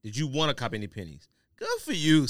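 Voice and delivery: ordinary talk, nothing marked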